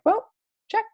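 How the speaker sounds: very high, sing-song mock pride; chirpy parody of a box-ticker; mock self-satisfied. Coach mocking someone who sends three invites, falsely pleased.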